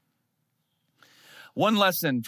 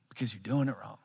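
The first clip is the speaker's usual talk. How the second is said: quiet punchline; 6 semitones lower, 7 dB quieter, faster than usual. Real, not acted.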